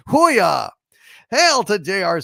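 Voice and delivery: loud, high whoop; cheer. Real, not acted. Hailing a viewer cancelling Disney Plus, loud cheer.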